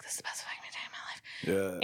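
hushed whisper of bliss; mock-blissful whisper as Bodhi. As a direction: whisper it riding the giant wave. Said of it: "and he's like".

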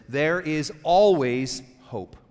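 ALWAYS stretched long and punched; slow down and lean hard on "always"; emphatic key point. Real, not acted. Preacher giving the lesson to write down, slow stress on always.

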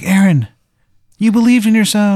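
very high, loud excited wizard; Wizard of Baltimore. Acted. Wizard greeting the player at the surface, jubilant cry. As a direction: exclaim it in a high, hammy put-on voice. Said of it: "The Wizard of Baltimore's there".

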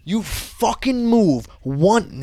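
slow, high-pitched acted menace; aggressive threat. Guard to a man without English, retold aggressive warning. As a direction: draw out each word as a threat. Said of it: "being very aggressive".

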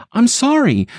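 high, anxious sorry; timid apology. Acted. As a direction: apologize nervously. Stacy apologizes to Harry.

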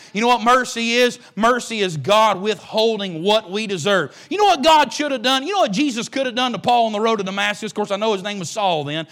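ordinary talk

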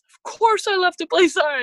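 high, loud, bursting protest; eager child insisting. Retold or imitated. Child in the back seat insisting to her dad, high and loud. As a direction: insist like a kid who'd hate to quit.

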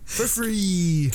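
high excited infomercial pitch; hard sell ad. Pretend. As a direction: squeal the free bonus like a pitchman. Infomercial offer.

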